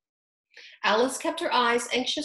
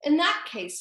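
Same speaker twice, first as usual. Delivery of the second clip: solemn Dodo; 6 semitones higher than usual. A narrator's reading. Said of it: "said the Dodo solemnly".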